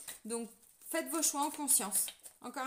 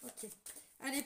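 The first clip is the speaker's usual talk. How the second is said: ordinary talk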